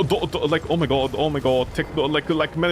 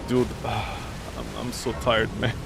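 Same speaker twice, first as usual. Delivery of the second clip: low, soft, weary voice with a sigh; worn-out sigh; 7 semitones lower, 6 dB quieter, slower than usual. Retold or imitated. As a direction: sigh it out like you're drained. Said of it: "And I'm like".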